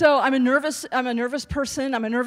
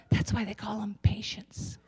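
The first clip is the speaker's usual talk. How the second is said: whisper; 2 semitones lower, 7 dB quieter than usual. Acted. Punchline aside.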